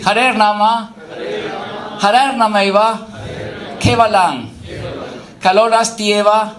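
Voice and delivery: measured, intoned verse quote; scripture recitation. Acted. Preacher quoting scripture to the congregation, intoned. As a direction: lightly intone the holy verse.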